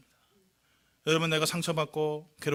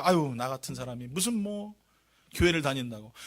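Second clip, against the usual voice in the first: self-doubting sigh; 6 semitones lower, 4 dB quieter than usual. Acted. Preacher voices a sinner's inner conflict, mild self-reproach. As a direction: mutedly voice a believer's self-doubt. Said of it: "마음속에 그런 갈등이 생겨요".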